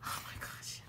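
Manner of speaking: hushed, overcome with excitement; excited whisper. Real, not acted. Vlogger overcome by her new fabric, excited whisper. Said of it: "I'm too excited right now".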